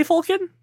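Falcon as "FAWL-kin", pitched high; questioned pronunciation of Falcon. Shown as a demonstration. Host challenging the movie's way, Falcon as "FAWL-kin". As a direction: echo Falcon with the "aw" of "fall". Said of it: "How do they say it?".

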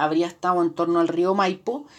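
ordinary delivery

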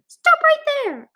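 loud, very high shout; yelled command. A narrator's reading. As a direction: yell it to halt an intruder. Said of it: "a voice yelled".

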